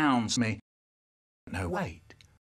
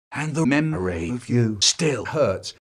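ordinary talk

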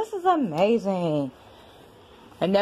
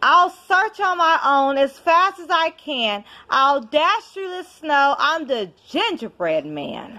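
lively storybook reading with rhyme; reading to a child; 4 semitones higher than usual. Acted. Parent reading a rhyming book to a child, storytelling voice. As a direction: read it like a bedtime picture book. Reads picture book aloud.

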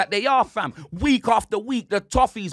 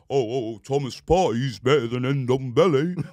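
lower, put-on mocking voice of a rival fan; mocking Robbie; 6 semitones lower than usual. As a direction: mimic him as a clueless fan. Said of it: "man like Robbie wants to talk".

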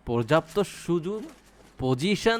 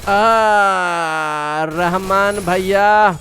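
drawn-out, louder slow call; mock calling out; 4 semitones higher, 8 dB louder, much slower than usual. Streamer teases enemy player Rahman, slow louder drawn-out call. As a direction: stretch the name out teasingly.

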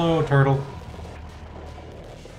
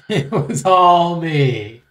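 mock confession; 4 dB louder, much slower than usual. Hammed confession to chat.

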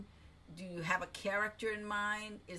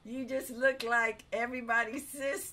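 loud, high burst of amused delight; amused teasing; 3 semitones higher, 8 dB louder than usual. Real, not acted. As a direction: tease a friend, voice lifted with laughter. Teasing the guest amid laughter.

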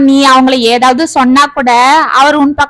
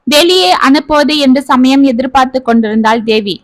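ordinary delivery, nothing marked

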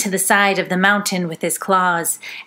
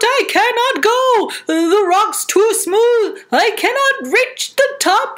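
panicked lion; 11 semitones higher, slower than usual. Acted. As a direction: yell as you slip down. Lion sliding down the rock.

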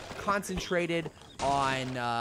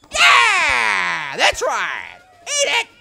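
loud, high triumphant shouts; gloating shout; victory yell; 10 semitones higher, 12 dB louder, much slower than usual. Real, not acted. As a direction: cheer the win at full volume.

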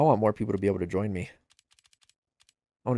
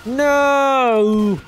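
long, high, loud wail of no; dismayed shout; 12 semitones higher, 7 dB louder than usual. Real, not acted. Player losing out in the game, high dismayed yell. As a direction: cry out in drawn-out dismay.

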